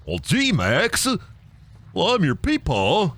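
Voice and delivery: slow, dopey cartoon dad; Goofy. Cartoon dad talking to his son Max, goofy drawl. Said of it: "like, a goofy voice".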